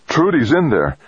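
high, anxious realization without shouting; gulped alarm. A narrator's reading. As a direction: gulp it out in dawning alarm. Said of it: "Rodriguez gulped".

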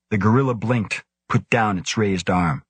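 ordinary delivery

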